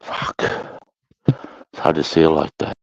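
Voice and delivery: usual speaking voice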